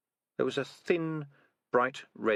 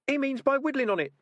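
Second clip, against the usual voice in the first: very high squeaky rat voice; a know-it-all little rat; 4 dB louder than usual. Acted.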